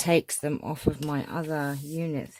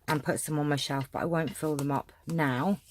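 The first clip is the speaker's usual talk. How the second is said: ordinary delivery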